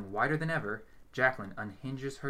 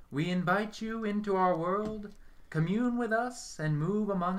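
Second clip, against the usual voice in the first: high, slow, solemn summoning; seance invocation; 10 semitones higher, 4 dB louder, slower than usual. A narrator's reading. Medium invites spirits into the dim room, slow and solemn. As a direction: intone it like a ritual.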